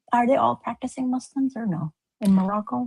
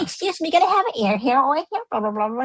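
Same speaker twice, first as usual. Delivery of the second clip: mocking her voice; 6 semitones higher, faster than usual. Host mocks the woman asking for hair oil, high mimicry. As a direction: sneer it in her dainty voice.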